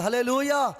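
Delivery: hallelujah called out loud to the crowd. Real, not acted. Preacher rousing the church, loud hallelujah. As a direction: lift the room with a loud hallelujah. Preacher calls congregation to praise.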